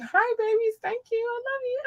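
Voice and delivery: put-on very high, babyish squeak; high-pitched baby talk; squeaky cutesy voice. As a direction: coo at the listeners like babies. Squeaky thank-you voice to listeners.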